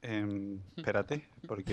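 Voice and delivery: talking without breathing, very hushed; holding breath. Real, not acted. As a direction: speak while holding your breath. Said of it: "estoy intentando hablar sin respirar".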